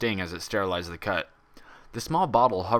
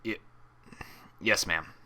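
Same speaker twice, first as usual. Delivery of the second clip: gulping nervous reply; 5 dB quieter than usual. A narrator's reading. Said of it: "Blaze gulped".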